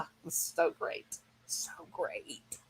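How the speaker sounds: hushed, breathy so great; whispered awe. Real, not acted. Remembering the dinosaur scene, whispered awe. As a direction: let it fall to a whisper. Awed by movie memory.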